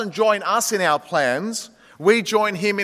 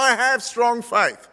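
higher, slow, insistent; insistent faith; 5 semitones higher, slower than usual. Acted. Dangling man insisting to God, higher and slow. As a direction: swear your faith while slipping.

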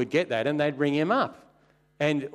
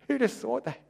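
laughing repeat; 10 semitones higher than usual. Shown as a demonstration.

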